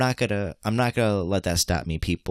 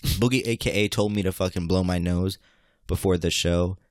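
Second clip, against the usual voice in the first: ordinary talk, nothing marked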